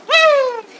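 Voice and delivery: high whooping wolf howl; howl imitation. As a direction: let out a shrill "woo" howl. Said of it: "It was more like".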